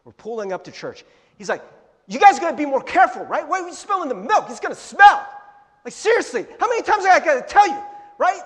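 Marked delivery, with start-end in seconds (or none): 2.1-8.4 loud, high-pitched exasperated scolding; dad yelling. Retold or imitated. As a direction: act out a fed-up dad yelling. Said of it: "my dad's, like, yelling at us".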